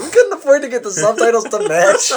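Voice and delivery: high, loud, laughing parody voice; mock excuse. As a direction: squeal it out as a silly imitated excuse. Voicing the game makers' excuse.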